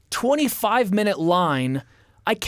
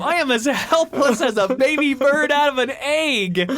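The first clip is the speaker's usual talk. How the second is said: mock panic; 8 semitones higher than usual. Skinny kid begging not to be jailed, mock panic. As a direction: plead helplessness in hammed-up distress. Panicked protest about going to jail.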